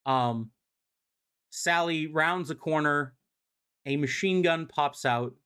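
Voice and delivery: ordinary delivery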